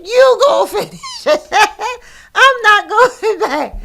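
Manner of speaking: loud, high retort breaking into laughter; angry retort. Retold or imitated. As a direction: retell the furious reply but laugh through it. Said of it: "I was so angry with her".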